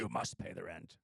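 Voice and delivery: low, menacing melodrama landlord; sneering panto villain. Retold or imitated. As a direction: growl it like a moustache-twirling villain. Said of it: "They had actors be like".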